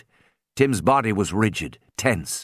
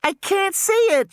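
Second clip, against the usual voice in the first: put-on very high frightened child cry; scared girl scream. A narrator's reading. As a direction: shriek it in panic. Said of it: "she screamed".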